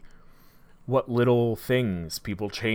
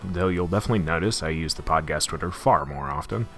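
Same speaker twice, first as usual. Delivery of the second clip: loud upbeat announcer voice; podcast promo read; 5 dB louder than usual. Acted. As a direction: sell the subscribe pitch. Promo read over music.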